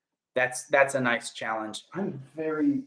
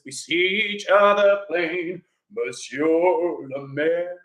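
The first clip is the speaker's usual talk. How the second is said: Les Mis confrontation; much slower than usual. Pretend. Actor in a livestream playing a Les Mis standoff, slow and dramatic. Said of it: "one man Les Mis".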